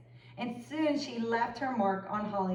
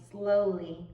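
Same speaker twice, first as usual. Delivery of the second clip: usual speaking voice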